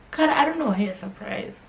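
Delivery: ordinary talk, nothing marked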